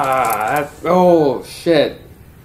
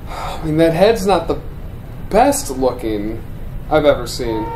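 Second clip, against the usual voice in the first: ordinary talk, nothing marked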